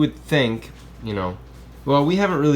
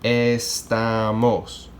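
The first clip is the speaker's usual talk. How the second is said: "Estamos" drawn out slowly as es-TA-mos; syllable by syllable; much slower than usual. Shown as a demonstration. Teacher counting out a three-syllable word, "Estamos" slowly split up. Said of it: "this is a three-syllable word".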